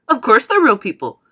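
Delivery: loud, brisk-spirited assurance; confident showman; Wonka, emphatic. Acted. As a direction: brush the doubt aside. Voices Wonka answering.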